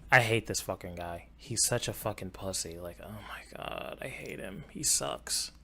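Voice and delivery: hushed, breathy venting about a troll; a real muttered rant, half voiced; whispered venting. Real, not acted. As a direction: whisper the insults under your breath.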